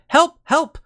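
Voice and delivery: high-pitched mock cry for help; nobleman crying for help. Player retelling a side quest, mimicking the nobleman's high-pitched plea. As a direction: play a distressed nobleman, squeaky-high and put on.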